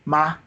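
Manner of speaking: sustained even, high "Ma"; first tone. Shown as a demonstration. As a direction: hold "Ma" at the top of your pitch. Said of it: "The first tone is high pitch".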